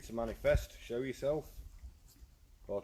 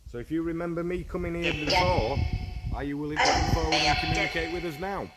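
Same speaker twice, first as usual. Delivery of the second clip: calling out to spirits; 5 semitones higher, 6 dB louder than usual. Acted. Calls out to spirit.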